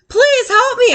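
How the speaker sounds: high, urgent plea; pleading. Acted. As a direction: beg for help on the phone. Boy begs Mister Carp on phone.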